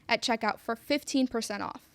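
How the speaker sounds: usual speaking voice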